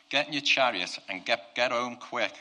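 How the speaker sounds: loud, urgent excited order; fired up, hurrying the king along; pumped up. Acted. Preacher as Elijah sending Ahab home, loud and pumped up. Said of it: "he was so pumped".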